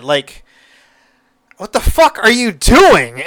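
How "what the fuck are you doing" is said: high, louder exasperated cry. Real, not acted. Reviewer yelling at the reckless daughter, exasperated. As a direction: throw up your hands at her.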